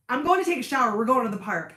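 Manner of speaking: loud, decisive retold declaration; brisk announcement. Retelling sister's blunt announcement.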